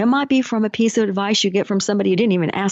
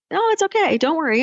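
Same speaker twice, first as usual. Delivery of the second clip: much higher, breezy no-no-no brush-off; stubborn refusal; 8 semitones higher than usual. Retold or imitated. Coach voicing the man turning down the motorboat, high and breezy. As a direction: wave the rescue away, oddly cheerful.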